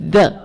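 "The" with tongue bitten and released; isolated, firm demonstration of "The". Shown as a demonstration. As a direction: model "The" with a voiced TH, not L. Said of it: "咬舌头".

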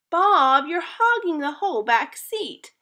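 complaining. Acted. Hannah complaining that Bob takes the back seat, high whiny protest. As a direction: whine at your brother. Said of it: "complained Hannah".

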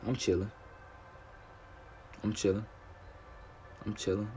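soft repeated murmur; quiet. Real, not acted. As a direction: fade it down.

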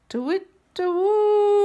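high hooting owl call. Acted. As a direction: hoot it like an owl. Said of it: "what can they hear".

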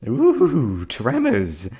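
high, loud, gleeful exclamation; thrilled at the side effect like a curious scientist; delighted fascination. Acted. Captor delighted by her captive's tremors, loud gleeful squeal. Captive shakes after injection.

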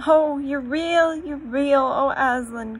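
high loud slow rejoicing; overjoyed, near tears; joyful cry. A narrator's reading. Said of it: "cried Lucy".

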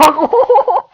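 short, very high laugh; high-pitched laugh. Real, not acted. Laughing at the tactic.

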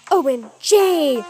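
loud, slow put-on "O M G"; slow loud excited gush; squeal. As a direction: gush like a fangirl. Thrilled about script.

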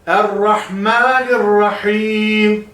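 Errahmanirrahim with a thick, dark ra, drawn out; heavy ra. Shown as a demonstration. Teacher shows the heavy ra to students, slow. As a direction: darken the ra in Errahmanirrahim, slowly. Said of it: "E'yi ince okuyacaksınız. Ra'yı kalın".

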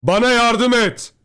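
high, loud, drawn-out cry; shouting for help. A narrator's reading. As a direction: holler desperately from far away. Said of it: "Babam karanlıktan tekrar bağırdı". (yardım için uzata uzata bağırarak)

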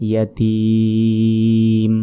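ইয়াতীম with the ী held about three seconds; drawn-out ইয়াতীম; long final vowel. Shown as a demonstration. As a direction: stretch the ending of ইয়াতীম. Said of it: "শেষে তিন second টান হবে".

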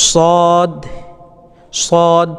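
সদ with a heavy s and long held vowel; drawn-out letter name. Shown as a demonstration. Teacher introducing the letter to learners, stretched সদ. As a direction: hold the vowel in সদ evenly. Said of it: "এক আলিফ পরিমাণ টান দেবেন".

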